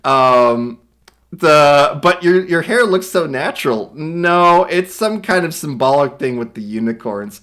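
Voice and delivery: ordinary talk, nothing marked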